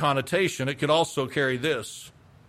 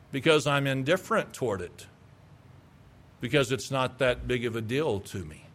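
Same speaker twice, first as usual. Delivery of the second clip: ordinary talk, nothing marked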